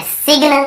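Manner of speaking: सिग्न said "sig-na"; wrong sounded g. Shown as a demonstration. Teacher mimics common mistake, सिग्न as "sig-na". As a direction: say सिग्न with short i and hard g. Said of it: "कई लोग सिग्न बोल देते".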